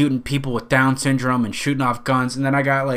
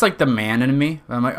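normal talking voice

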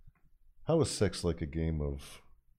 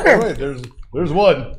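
laughing; 7 semitones higher, 12 dB louder, slower than usual. Real, not acted. Laughing through his own joke.

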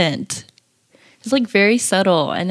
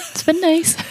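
high, giggly laugh through the words; 12 semitones higher than usual. Real, not acted. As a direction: let the laugh break the line. Laughs while joking about free time.

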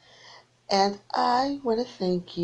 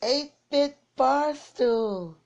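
drawn-out greeting call; 8 semitones higher, much slower than usual. Real, not acted. Host greeting a caller on air.

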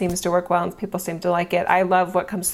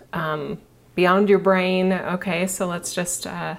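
calm her listeners into their bodies; soothing massage murmur. Acted. Leads breathing relaxation exercise.

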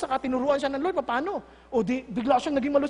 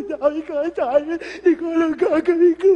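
mock wailing over homework; crying child; 7 dB louder than usual. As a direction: bawl loudly like an overwhelmed child. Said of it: "minsan mga anak, iiyak". (kunwaring ngumangawang bata)

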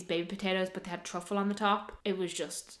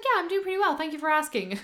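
chirpy waitress; 8 semitones higher, faster than usual. Retold or imitated. Quoting waitress's chirpy answer.

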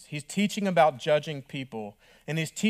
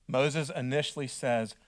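ordinary talk, nothing marked

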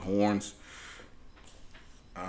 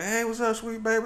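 put-on higher, tender hello to a child; sweet uncle greeting; 11 semitones higher than usual. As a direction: coo at the little one.

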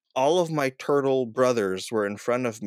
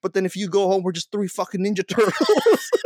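high-pitched mock tirade, breaking into a laugh at the end; retold angry yelling; 6 semitones higher than usual. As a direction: rant at a drunk cousin, only half in earnest. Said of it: "I'm just yelling at Raphael".

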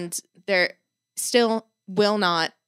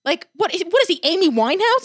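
incredulous; 8 semitones higher, 4 dB louder than usual. Real, not acted. Incredulous rant about drugged dog.

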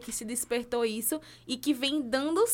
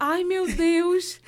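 mock panic; 7 semitones higher, 7 dB louder, much slower than usual. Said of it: "Depois ela".

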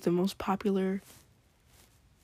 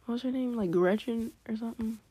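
usual speaking voice

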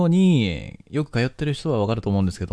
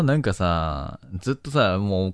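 usual speaking voice

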